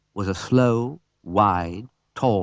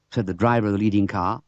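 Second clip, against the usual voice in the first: plain reading voice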